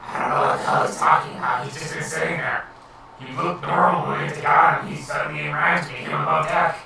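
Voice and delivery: low, rough goblin grumble. Acted. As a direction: sneer like a goblin guard. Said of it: "The goblin looks and he goes".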